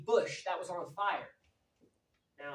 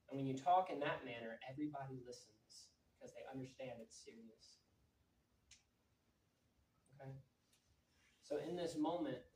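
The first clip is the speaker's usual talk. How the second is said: much softer, grave and low; solemn hush; 8 semitones lower, 12 dB quieter than usual. Real, not acted. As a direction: keep it quiet and serious. Held solemn quiet prayer request.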